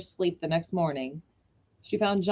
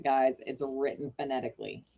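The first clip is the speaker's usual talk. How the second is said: usual speaking voice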